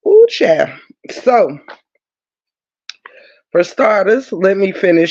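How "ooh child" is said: sassy high exclamation. Real, not acted. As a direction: squeal it up high. Exclamation about heat.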